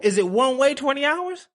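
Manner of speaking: high-pitched, disbelieving check; incredulous question. Real, not acted. Host checking if twenty hours is one way, incredulous. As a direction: ask as if it cannot be true. Checks the shocking flight length.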